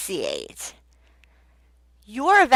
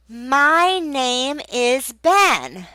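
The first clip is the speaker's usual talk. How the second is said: modelled full sentence; 3 semitones higher, much slower than usual. Shown as a demonstration. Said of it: "you wanna correct that".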